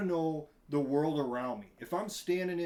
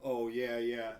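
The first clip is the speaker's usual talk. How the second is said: ordinary talk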